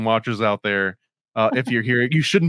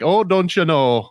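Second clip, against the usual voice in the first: slow, higher mock Canadian "Oh, don't you know?" with a long "Oh"; mock Canadian accent; 5 semitones higher, slower than usual. Host mocking how Canadians sound, mock Canadian accent. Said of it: "Even the accent sounds like".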